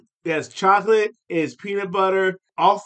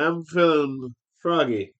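lightly croaked, slow froggy line; a hint of a frog voice; mock froggy voice; 3 semitones lower, slower than usual. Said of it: "So he's busy mocking his father".

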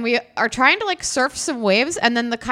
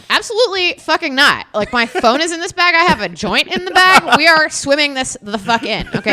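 emphatic refusal; 6 semitones higher, 7 dB louder than usual. Retold or imitated. Refusing to abandon the bag.

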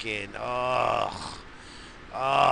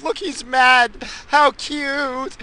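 loud, very high teasing squeal; mocking coo; 13 semitones higher, 8 dB louder, much slower than usual. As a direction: tease in a high, gushing voice. Teasing angry son, to wife.

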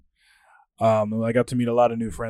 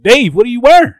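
loud, high imitated Southern holler; mock a friend's Southern accent, shouting; 11 semitones higher, 14 dB louder than usual. Groom confronts a guest about his suit, Southern accent. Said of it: "of course in his southern accent".

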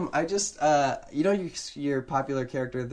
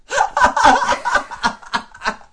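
bursting out laughing; big shrieking laugh; loud high laugh; 13 dB louder than usual. Real, not acted.

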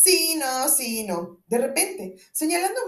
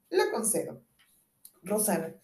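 ordinary talk

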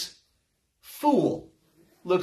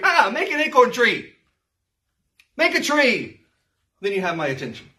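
mock challenge; 4 semitones higher than usual. God taunting the idol maker, higher mocking challenge. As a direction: dare him to make a tree. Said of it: "God challenges the idol maker".

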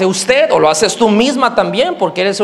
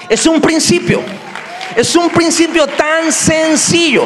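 preaching shout; 7 semitones higher than usual. Real, not acted. Preacher driving home his point.

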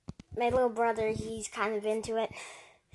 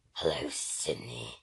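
much lower, slow menacing caller voice; masked killer on the phone; slower than usual. Pretend. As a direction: drop deep and slow like the Scream killer calling. Killer calls victim in Scream.

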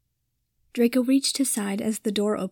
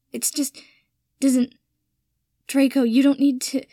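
tripping over words; 4 semitones higher, 6 dB louder than usual. Acted. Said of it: "he tripped over the words".

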